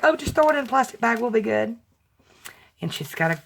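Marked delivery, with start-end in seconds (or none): 0.0-1.8 higher, quick, offhand self-mockery; breezy self-quote. Admitting she runs late with packaging, breezy self-mockery. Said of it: "I'm like".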